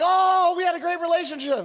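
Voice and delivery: high, loud sarcastic gushing; mock sweet farewell. Pastor joking about strangers leaving his row, mock gushing. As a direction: gush fake fondness at the leaving couple. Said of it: "I was like".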